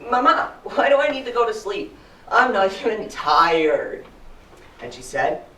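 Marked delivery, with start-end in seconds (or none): 0.1-4.0 put-on higher-pitched kid, dragging out the protest; whining little boy. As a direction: whine it like a child refusing bedtime. Acts his child self whining to mom.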